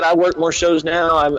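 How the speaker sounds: normal talking voice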